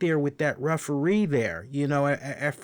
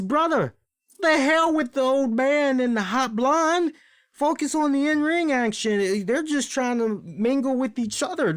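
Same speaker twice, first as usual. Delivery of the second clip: scolding the ref; 9 semitones higher than usual. Retold or imitated. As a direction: urge him to focus, exasperated. Voicing Arn scolding the ref.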